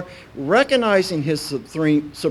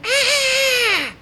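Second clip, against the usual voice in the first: high comic shriek; high-pitched "Ah!" of exaggerated dread; mock panicked scream; 4 dB louder than usual. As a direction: scream as if fleeing in horror. Imitates people running away from authority.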